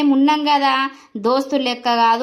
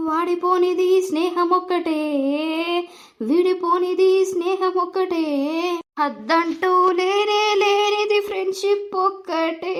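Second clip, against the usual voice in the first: poetic recitation; 4 semitones higher, slower than usual. Acted. Friend consoling the crying boy with a slow recited verse. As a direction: recite it slowly like a heartfelt poem.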